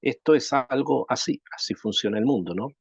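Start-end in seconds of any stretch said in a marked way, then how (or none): none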